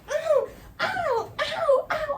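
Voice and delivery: very high strained ows; pained yelping. Retold or imitated. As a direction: yelp each ow. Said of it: "and I'm like".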